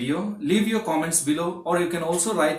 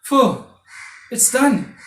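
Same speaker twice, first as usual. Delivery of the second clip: relieved sigh; 5 semitones higher, 5 dB louder, slower than usual. Shown as a demonstration. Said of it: "What is your reaction?".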